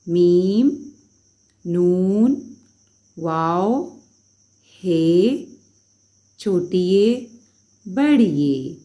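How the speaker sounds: loud, spaced letter names with pauses; alphabet recitation. Acted. Teacher reciting letters aloud for the class. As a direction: call out each letter for children to repeat.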